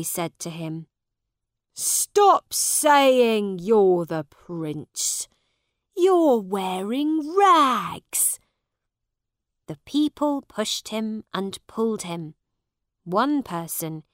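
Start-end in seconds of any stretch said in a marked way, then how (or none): 1.7-8.4 loud, very slow angry scolding. A narrator's reading. Angry people to the ragged boy, scolding. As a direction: scold him loudly, stretching each word. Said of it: "They were angry with him now".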